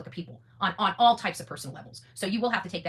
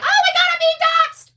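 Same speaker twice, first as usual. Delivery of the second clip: hammed-up shrill squeal, much higher and louder, slow; mock panic; 13 dB louder, slower than usual. Mocking critics who cry about being doxxed, shrieking squeal. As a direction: squeal it like a drama queen caught out. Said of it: "they're like".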